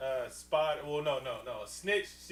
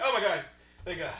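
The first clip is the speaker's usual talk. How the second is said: relieved exclamation; 8 dB louder, much faster than usual. Acted. Retelling how a woman spotting a soldier should react, relieved exclamation.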